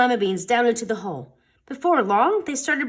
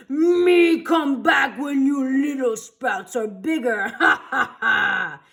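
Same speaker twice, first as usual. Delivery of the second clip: slow, loud monster voice laughing; mock the little kids as a giant; 4 semitones higher, 4 dB louder, slower than usual. A narrator's reading. The monster to the kids, laughing loudly.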